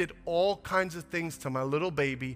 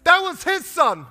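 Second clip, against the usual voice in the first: high, loud, shouted proclamation; impassioned sermon climax at top voice; full-force preaching; 11 semitones higher, 11 dB louder than usual. Real, not acted. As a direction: thunder it out to the congregation, phrase by phrase.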